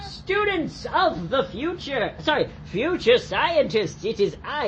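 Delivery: high, slow, booming proclamation; grand entrance. Pretend. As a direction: arrive like a heroic visitor. Grand entrance as time traveller.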